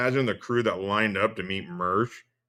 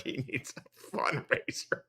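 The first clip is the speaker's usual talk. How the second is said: mocking whisper; 2 semitones higher, 9 dB quieter than usual. Real, not acted. Mocking aside.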